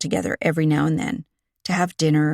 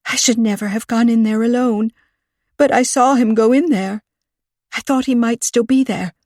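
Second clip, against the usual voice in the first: breathless; 5 semitones higher, 4 dB louder than usual. A narrator's reading. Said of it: "She gasped for breath".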